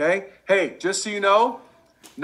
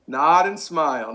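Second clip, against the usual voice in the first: slow demo. Shown as a demonstration. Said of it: "You gotta be like a bobblehead".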